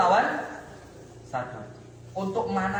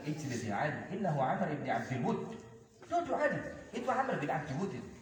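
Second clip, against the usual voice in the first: soft, lowered, patient warning; quiet restraint; 5 semitones lower, 9 dB quieter than usual. Acted. The Prophet warning Ali to sit, softly. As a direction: keep it down and hold him back.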